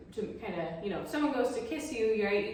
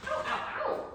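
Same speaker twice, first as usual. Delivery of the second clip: sudden high yelps of real pain; 4 dB louder than usual. Real, not acted. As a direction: yelp as your hair gets pulled. Dog grabs her hair.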